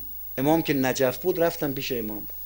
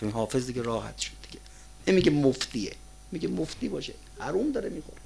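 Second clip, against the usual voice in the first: ordinary delivery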